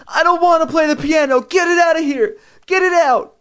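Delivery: put-on hysterical high-pitched child's scream; full-tilt tantrum screaming. A narrator's reading. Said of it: "I started to scream".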